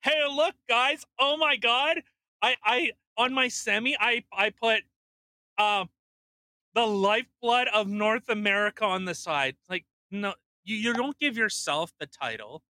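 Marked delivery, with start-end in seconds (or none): 0.0-9.6 higher, louder boastful show-off; hammed-up bragging at work; loud mock brag to the guys; mock self-important trucker. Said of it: "what if I went to work and like".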